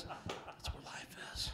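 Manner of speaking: slow, laughing whisper; whispered mock awe. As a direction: hush it like a revelation. Mock-sincere aside about mullets.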